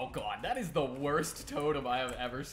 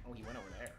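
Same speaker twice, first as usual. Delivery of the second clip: normal talking voice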